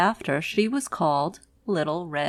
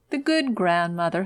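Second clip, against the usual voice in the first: usual speaking voice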